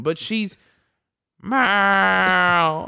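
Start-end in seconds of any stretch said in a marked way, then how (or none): 1.4-2.9 high, loud mock cat meow; imitated meow. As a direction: meow like the needy cat at the door. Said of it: "just meowing".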